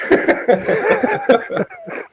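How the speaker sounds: long, very high peal of laughter; laughing. Real, not acted. Cracking up at own joke about the cashier, laughing.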